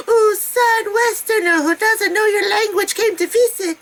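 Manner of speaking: high, laughing mock-pitiful tourist; hammed-up sad Westerner, giggling. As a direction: play the helpless foreigner for laughs. Self-mocking helpless tourist.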